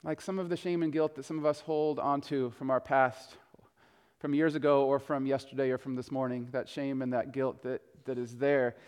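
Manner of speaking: low, quiet, weighty aside to the congregation; hushed, sombre reflection. Real, not acted. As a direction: speak gently into a heavy silence. Reflecting softly on shame and guilt.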